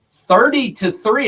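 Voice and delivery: incredulous shout of the score; excited repeat. Real, not acted. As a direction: say it again in loud disbelief. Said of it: "It's thirty to three".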